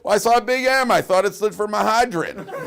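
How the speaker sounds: loud high punchline through a laugh. Acted. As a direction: deliver the joke while laughing.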